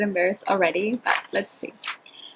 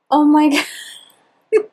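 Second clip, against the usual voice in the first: laughing; 6 semitones higher, 8 dB louder than usual. Real, not acted. Laughing at old diary.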